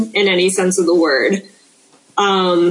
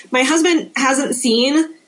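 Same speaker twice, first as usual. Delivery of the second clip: normal talking voice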